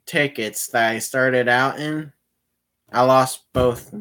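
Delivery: usual speaking voice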